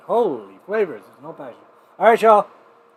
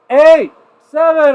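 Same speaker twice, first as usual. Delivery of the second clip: slow, much higher and louder count; shouted countdown; 13 semitones higher, 12 dB louder, much slower than usual. Real, not acted. Host counting down to viewers for the toast, loud and slow. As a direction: belt out the numbers to the crowd.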